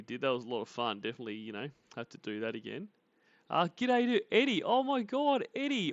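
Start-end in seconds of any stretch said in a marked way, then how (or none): 3.5-5.9 high and loud delighted hello; excited greeting. Real, not acted. Excited greeting of returning viewer.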